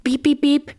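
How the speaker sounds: high electronic beep imitation; three high-pitched mock beeps; imitated key-card beeps. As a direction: mimic a door lock beeping, high and even. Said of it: "all of a sudden I hear".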